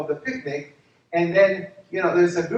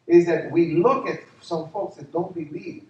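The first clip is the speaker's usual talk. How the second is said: normal talking voice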